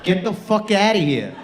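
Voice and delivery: lightly put-on New York accent; hint of a brash New Yorker. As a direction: tell them off like a New Yorker. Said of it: "You turn New Yorker for some reason".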